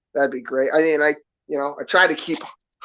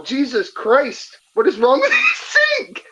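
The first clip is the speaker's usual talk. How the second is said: laughing disbelief; 9 semitones higher than usual. Real, not acted. Streamer laughs at the guest's roaring sink. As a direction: laugh it out at the absurd noise.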